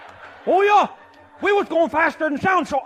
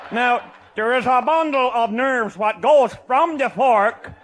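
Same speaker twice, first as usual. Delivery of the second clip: ordinary talk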